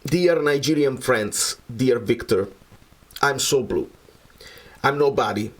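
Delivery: ordinary delivery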